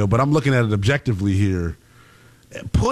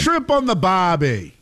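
mock Australian accent; 6 semitones higher than usual. Keeps up the Aussie joke.

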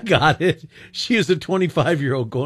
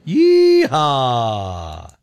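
rodeo yeehaw. Real, not acted. Host's rodeo call.

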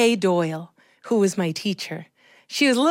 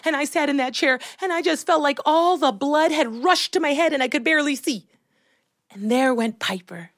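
usual speaking voice

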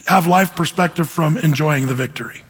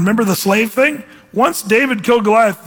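ordinary talk, nothing marked

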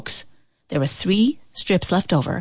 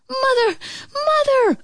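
very high urgent call. A narrator's reading. Boy calls his mother as he hurries in, urgent. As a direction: call for her while rushing in. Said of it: "called Radius".

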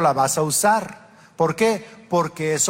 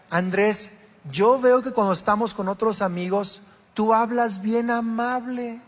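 higher, slower, gently pleading wife voice; quoting his wife; 6 semitones higher, slower than usual. Retold or imitated. Wife asks her husband to speak to her kindly, lightly voiced. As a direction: ask him sweetly why he's never this kind to you. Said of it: "Kelly me decía".

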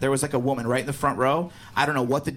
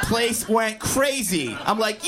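normal talking voice

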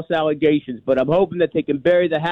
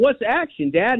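put-on kid asking dad; 8 semitones higher than usual. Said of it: "I'm yelling".

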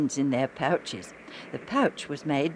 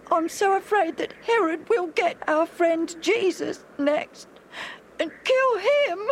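frightened whisper; 4 dB louder than usual. A narrator's reading. Alf fears Herod will kill Jesus.

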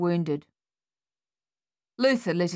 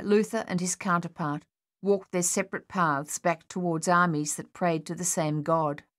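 ordinary delivery

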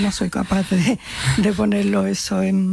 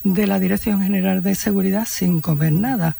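usual speaking voice

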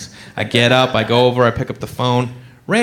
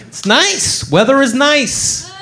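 nervous lie; 7 semitones higher, much slower than usual. Retold or imitated. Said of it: "I'm like".

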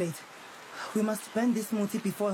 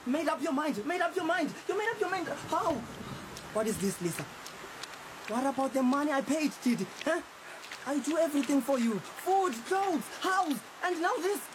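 very high, laughing scorn turning to shouted grievance; mock her words then rant about all he gives; mocking outrage; 7 semitones higher than usual. Mocking her decision, angry.

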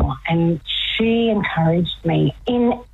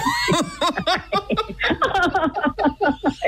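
high laugh; 3 dB quieter than usual. Real, not acted. Laughing at wanting to skip work.

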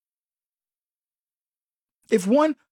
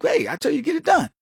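grandmother scolding; 7 semitones higher, faster than usual. Retold or imitated. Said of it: "she was like".

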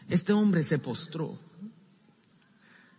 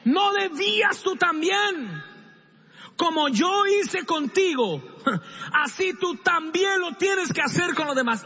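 king's rebuke, loud; 13 semitones higher, 3 dB louder than usual. Acted. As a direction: thunder it like the king. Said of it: "quiero que repita eso fuerte".

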